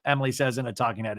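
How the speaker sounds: ordinary talk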